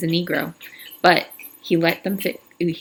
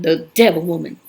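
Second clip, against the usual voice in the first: louder accusing shout; 4 dB louder than usual. Acted. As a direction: spit the accusation at her. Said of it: "Trout shouted".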